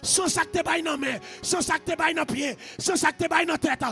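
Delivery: loud, driving deliverance prayer; full-force preaching. Real, not acted. Preacher commands spirits in Jesus' name, full-force shouted preaching. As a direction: hammer out each repeated phrase at shouting force, stretching the holy name.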